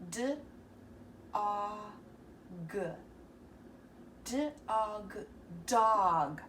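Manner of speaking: each letter sound, then blended into dog. Shown as a demonstration. Teacher to kids, sounding out a word. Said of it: "Let's practice".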